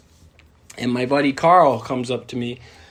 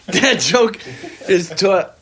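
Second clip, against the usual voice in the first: laughing; 9 semitones higher, 6 dB louder, slower than usual. Real, not acted. Laughing after the punchline bombs.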